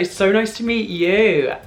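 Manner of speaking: high, slow, drawn-out delight; gushing greeting. Retold or imitated. Star returns a fan's greeting, gushing.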